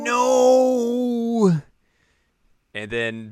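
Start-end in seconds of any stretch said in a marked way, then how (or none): 0.0-1.5 high, loud drawn-out no; mock refusal. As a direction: stretch the no like a protest. Echoing Kanan's refusal.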